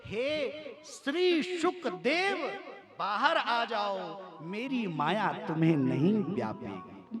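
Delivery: loud, long-drawn divine summons; God's proclamation. Acted. The Lord calling the child out of the womb, grand slow proclamation. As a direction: call out grandly, stretching each word. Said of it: "भगवान क्या बोले".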